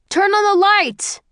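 high, loud urgent shout. A narrator's reading. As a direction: yell it across a dark room. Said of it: "someone shouts".

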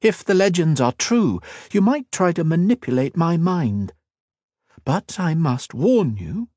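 high, poised royal voice, slowing at the end; a proud queen making a veiled threat; cool queen voice. Acted. The Queen speaks to Ethan.

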